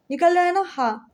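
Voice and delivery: normal talking voice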